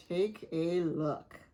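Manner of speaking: ordinary delivery, nothing marked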